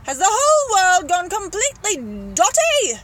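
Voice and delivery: very loud, high, incredulous outburst; bellowing. Acted. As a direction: bellow as if about to have a fit. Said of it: "he bellowed".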